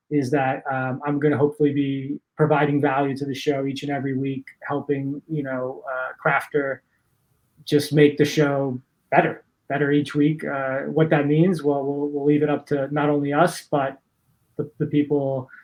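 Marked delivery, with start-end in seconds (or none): none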